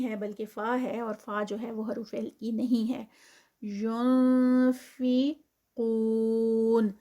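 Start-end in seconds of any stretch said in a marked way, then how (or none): 3.6-6.9 ینفقون with heavy قاف and long واو; slow recitation. Shown as a demonstration. Teacher models the word for pupils, slow recitation. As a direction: draw out ینفقون slowly, four counts on the واو. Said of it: "قاف، آ، ... heavy".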